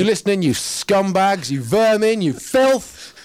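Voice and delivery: loud, high, slow hurled abuse; mock-angry rant. Poet addresses the listening censors, loud mock-angry abuse.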